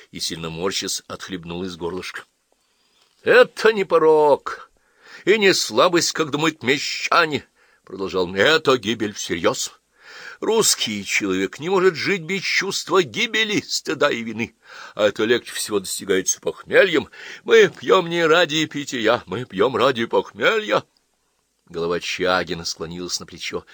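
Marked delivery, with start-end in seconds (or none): 3.2-7.4 high, loud, slow proclamation; drunken father orating. Acted. Father defends his drinking, orating grandly. 8.4-20.8 put-on drunken father orating. Father rambles on about drinking, orating.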